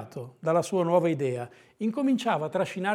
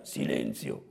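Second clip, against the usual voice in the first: hushed, rasping mock yell; very low, hoarse stage shout; yelled command; 9 dB quieter, slower than usual. A narrator's reading. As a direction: growl it softly as a stern order. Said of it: "urlò Calabrone".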